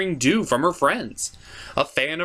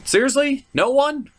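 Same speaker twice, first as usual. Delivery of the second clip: incredulous plea; 10 semitones higher than usual. Real, not acted. Still nobody agrees.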